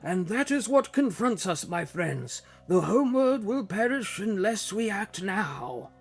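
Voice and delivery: high, aged elder's voice; wise old man voice. Acted. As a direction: speak as a weary old sage. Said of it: "generic, wise old man voice".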